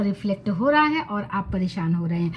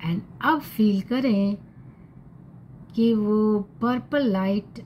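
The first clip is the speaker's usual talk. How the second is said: calm meditation guide, unhurried with long pauses; guided meditation; 5 dB quieter than usual. Acted. Guiding listeners to breathe and visualize purple light, calm meditation voice. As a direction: gently lead them through it, softening at phrase ends.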